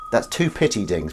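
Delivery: usual speaking voice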